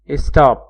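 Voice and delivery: स्टॉप said as English stop, short open o; स्टॉप with a clean short o sound; clear model word. Shown as a demonstration. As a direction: model स्टॉप crisply for the class. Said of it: "ओ की आवाज आ रही है".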